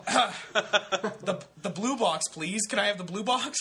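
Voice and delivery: high, loud, stammered mock pleading; breathless. Winded customer begging the cashier for condoms, acted breathless plea. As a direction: act winded and desperate to be served. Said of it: "I'm out of breath".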